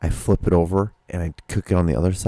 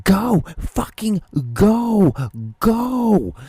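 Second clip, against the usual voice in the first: much higher and louder yelling, a long drawn-out middle go; screaming at the car; 13 semitones higher, 5 dB louder, much slower than usual. Retold or imitated. Stuck at a green light behind protesters, screaming much higher and louder. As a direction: lay on the scream at the car ahead that won't move. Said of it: "fucking was screaming".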